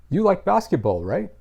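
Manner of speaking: statement that lifts into a rising right?; a checking question with the rise on right?; tag question. Shown as a demonstration. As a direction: say You like basketball flat, then a rising right?. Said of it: "Notice what I did there".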